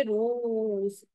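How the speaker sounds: रूस very slow, oo held long; रूस as a dictation word with a drawn-out oo; long vowel. Shown as a demonstration. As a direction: stretch the oo in रूस right out. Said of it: "long ऊ का sound आ रहा है".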